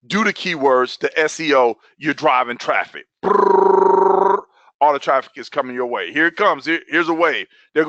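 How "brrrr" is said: loud, high rolled brrr; imitated rush noise. Coach imitating traffic rolling in, loud trilled brrr. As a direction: trill one long high burst.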